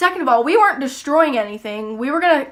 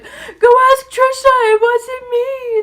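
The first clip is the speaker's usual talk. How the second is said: much higher, slow, louder wailing plea; fake wailing; 11 semitones higher, 4 dB louder, slower than usual. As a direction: wail it out like a pleading child. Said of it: "And so I'm, like, crying".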